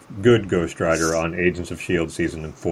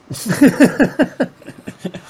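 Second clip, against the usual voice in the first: high laugh; 6 dB louder than usual. Real, not acted. Laughs at joke.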